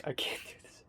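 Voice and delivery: whispered, drained and sleepy; exhausted whisper. Real, not acted. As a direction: breathe it out, worn out. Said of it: "I'm so sleepy and tired".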